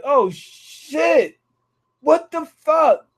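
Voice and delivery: high, shocked voicing of Jack; startled Jack. Jack looking up at the giant elephant, voiced startled. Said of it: "Jack looked up like".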